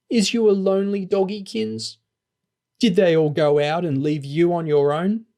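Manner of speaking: put-on high, cooing baby talk; baby talk to a dog. A narrator's reading. Lauren cooing at her lonely dog in high baby talk. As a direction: coo at the dog like a doting owner.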